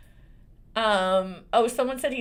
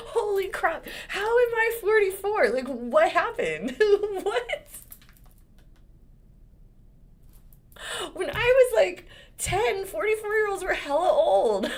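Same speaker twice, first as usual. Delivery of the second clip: high, giggly disbelief, a bit louder; laughing disbelief; 12 semitones higher, 4 dB louder than usual. Real, not acted. Telling viewers she can't believe she's forty-four, laughing high. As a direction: laugh through the shock of your age.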